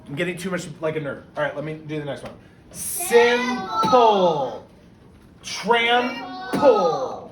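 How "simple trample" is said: "Simple" and "Trample" with each syllable stressed; emphasized syllables. Shown as a demonstration. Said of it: "I was emphasizing so you know where the syllables are".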